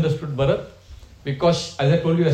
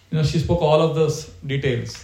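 normal talking voice

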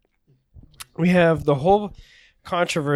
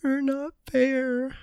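much higher and slow, hammed-up hurt feelings; mock emotional show; 9 semitones higher, 3 dB quieter, slower than usual. Host mocking a fighter's emotional show, high slow hammed-up hurt. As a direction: lay on the wounded act, mocking. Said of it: "he's putting on this little emotional show".

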